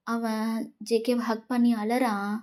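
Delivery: ordinary delivery, nothing marked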